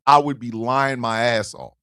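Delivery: normal talking voice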